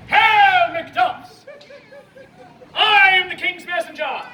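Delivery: loud, high theatrical herald; hailing loudly, first word hit hard; grand proclamation. Acted. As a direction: announce it like a royal messenger. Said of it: "She strikes a dramatic pose".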